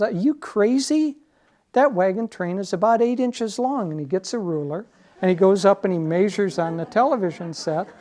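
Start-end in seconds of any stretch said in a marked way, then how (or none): 0.0-3.9 high, loud scoffing hippie voice; mocking hippie. Hippie laughing at the regular guy, high and loud. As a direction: scoff at your friend's foolishness. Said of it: "The hippie laughs and says".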